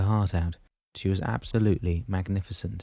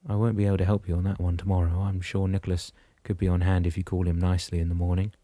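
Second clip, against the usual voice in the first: plain reading voice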